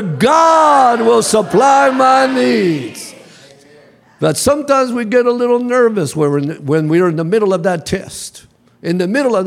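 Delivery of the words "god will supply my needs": slow, drawn-out shouted declaration; even louder. Shown as a demonstration. Preacher urging the congregation a little bit louder. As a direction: proclaim it, every word stretched. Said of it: "A little bit louder".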